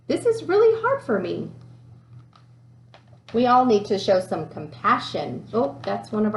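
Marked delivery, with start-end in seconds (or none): 0.1-1.5 higher, a touch plaintive; struggling boy. Retold or imitated. Boy at his desk admitting math is hard, a little plaintive. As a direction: sound like a kid near giving up. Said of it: "the boy sitting there saying".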